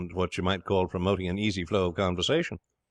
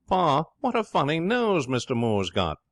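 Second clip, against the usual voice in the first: put-on high-pitched cheeky kid; cheeky small brother; 8 semitones higher, 4 dB louder than usual. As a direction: pipe up high, like an impudent little brother. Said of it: "I told you he was cheeky".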